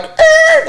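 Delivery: very high squeal mimicking a car tearing off; short high-pitched tire-squeal noise; imitated car screech. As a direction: screech like tires peeling out. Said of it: "I can hear him like".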